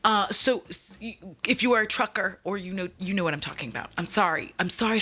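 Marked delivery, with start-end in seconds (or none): none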